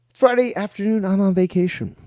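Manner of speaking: high, loud cheery announcement; upbeat host voice. Real, not acted. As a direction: sell it like a hyped host.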